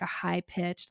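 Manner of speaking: normal talking voice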